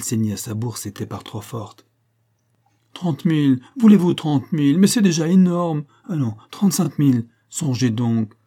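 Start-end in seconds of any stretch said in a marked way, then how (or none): 2.9-8.3 high, loud, pressing offers; urgent haggling. A narrator's reading. Academician bargaining with the dealer, urgent haggling. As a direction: plead and push the price in alarm. Acted haggling over a price.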